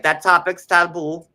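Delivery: put-on Trinidadian accent, waving off the baby question; lightly voiced accent quote. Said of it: "now I'm, like, in the accent".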